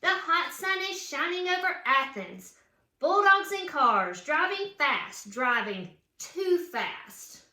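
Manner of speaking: lively read-aloud storybook voice; reading to children. A narrator's reading. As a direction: read it like a picture book for kids. Reads picture book aloud.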